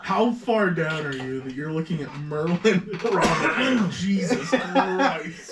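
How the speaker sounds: real laughter running through the words; incredulous laughter. Real, not acted. Host teasing a friend over an obscure pick, laughing incredulously. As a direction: crack up in disbelief, dragging the words out.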